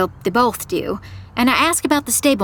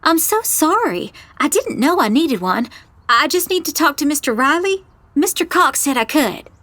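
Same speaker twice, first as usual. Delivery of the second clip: put-on innocent student, pitched higher; college girl innocence; 6 semitones higher than usual. A narrator's reading. Visitor sweet-talking receptionist over a missing badge, feigned innocence. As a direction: play sweetly clueless to get past the desk. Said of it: "I put on my best college girl innocence".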